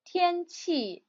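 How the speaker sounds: 天气 with first then fourth tone, clearly modelled; practicing tones. Shown as a demonstration. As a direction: say 天气: high level tian, falling qi. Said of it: "first and fourth tone".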